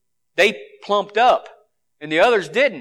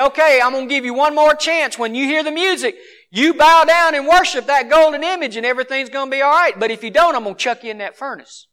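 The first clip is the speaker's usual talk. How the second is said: put-on higher-pitched southern-accented king; 7 semitones higher, 3 dB louder than usual. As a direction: play the king as a Southerner laying down terms. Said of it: "had a southern accent".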